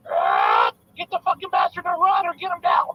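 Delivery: croaked squawk into high, loud parrot voice. Acted. Parrot answering the preacher in a joke, high loud squawking. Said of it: "And the parrot goes".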